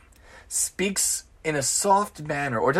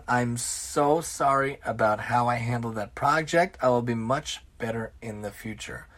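soft, lower, gentle reply; calm apology; 5 semitones lower, 6 dB quieter than usual. Acted. Employee calmly answering an enraged boss, gentle apology. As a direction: defuse him with quiet calm. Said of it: "The best way to respond".

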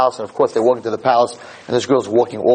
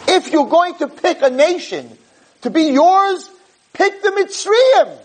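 much higher, indignant angel voice; protesting angels; slower than usual. Acted. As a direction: plead as scornful angels, pitched way up.

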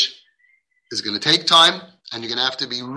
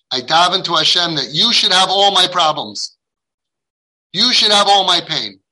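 acted retort, a touch louder; bitter retort; 3 semitones higher, 5 dB louder than usual. Man in pain answering the rabbi, acted bitter retort. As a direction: throw the curse back as a mock prayer.

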